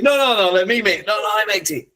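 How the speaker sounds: play-fight over who makes the tea; mock squabble. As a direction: pretend insistence, half laughing.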